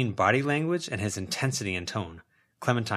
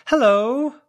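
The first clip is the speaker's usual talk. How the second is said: loud high call across the field; 10 dB louder than usual. A narrator's reading. Daniel hailing the girl in the woods, loud call. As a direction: hail her from a distance. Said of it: "Daniel called out".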